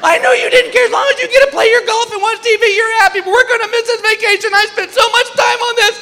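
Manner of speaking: very high, hammed-up wailing; tearful wife. Wife blaming husband in the security line, tearful wailing. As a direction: wail through a mock tearful tirade. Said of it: "Here comes the tears".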